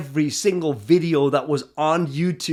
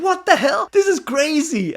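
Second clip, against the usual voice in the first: high-pitched, astonished exclamation; amazed disbelief; 11 semitones higher than usual. Retold or imitated. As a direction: blurt it out in happy disbelief. Reliving amazement at the coincidence.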